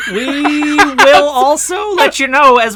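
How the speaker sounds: high, very slow and loud; mock dramatic announcer. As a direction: drag it out like a grand announcer. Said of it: "I enjoyed saying it".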